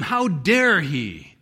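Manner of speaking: slow, drawn-out offended challenge; shocked indignation. Acted. Scribes reacting to Jesus' claim, shocked and indignant. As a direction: lean hard on the affront, slowly. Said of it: "That was shocking to them".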